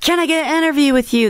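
high, eager fan voice. Retold or imitated. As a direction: mimic pushy college kids. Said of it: "these college kids are like".

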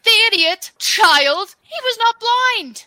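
high, loud indignant outburst; exasperated scolding. Acted. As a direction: scold the gullible child, pitch climbing. Captive scolds the gullible rescuer.

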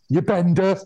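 mock angry shout. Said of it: "looked at him with an angry face and he went".

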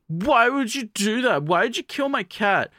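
put-on a boy crying out over his lost cat; mock distraught child. Little brother finds cat gone.

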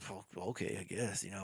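very quiet, low mumble; low, hesitant imitation; reluctant agreement. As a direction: give in softly, unsure, almost under your breath. Voices girl's reluctant answer.

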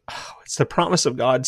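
breathy whispered aside. Real, not acted. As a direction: hush it to a whisper. Whispered repeat of phrase.

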